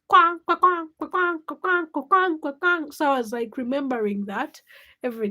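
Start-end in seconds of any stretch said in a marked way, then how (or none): 0.1-2.9 higher, louder heel noise; click-clack like a loose sole; clacking heel sound. Retold or imitated. Imitating the broken heel on cement, loud. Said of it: "it is making noise".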